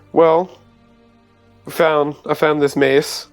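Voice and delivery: normal talking voice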